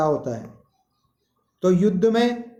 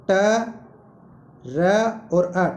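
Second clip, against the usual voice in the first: slow sound-by-sound breakdown; much slower than usual. Shown as a demonstration. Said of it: "ट्र को बोल के देखो".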